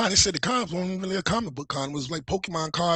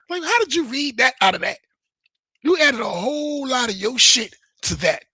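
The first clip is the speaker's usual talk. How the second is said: louder, higher exasperated challenge; indignant; 8 semitones higher, 4 dB louder than usual. Real, not acted. Streamer calling out Ethan's exaggeration. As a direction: call the spin out. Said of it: "indignant retort".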